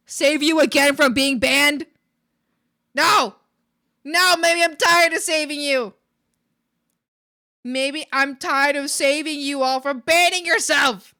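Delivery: mock outrage at full volume; exasperated scolding. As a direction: scold loudly, stretching the words.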